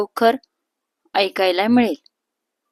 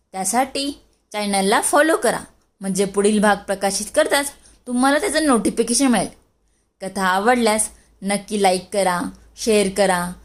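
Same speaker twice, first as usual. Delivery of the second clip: plain reading voice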